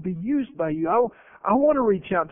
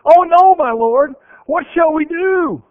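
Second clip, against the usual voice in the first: a sudden loud, high cry of alarm that slows on the plea; Elisha's servant, seeing the army at dawn, crying out in alarm; panicked servant; 9 semitones higher, 7 dB louder, slower than usual. Acted. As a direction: cry out in panic to your master. Servant sees the surrounding army.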